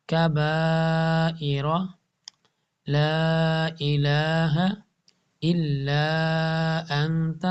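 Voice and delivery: slow recitation with long madd after لام in الا; Quran recitation. Shown as a demonstration. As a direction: draw out الا, then انت. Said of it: "لا الہ الا انت پڑھتے ہیں". (آہستہ تلاوت، الا میں لمبی مد)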